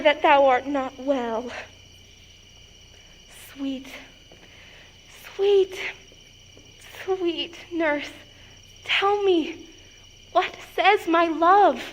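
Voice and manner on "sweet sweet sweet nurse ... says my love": soft, slow sweet-talking; tender coaxing. Acted. Girl coaxing her nurse for love's message, tender coaxing. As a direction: wheedle gently, leaving space between the words.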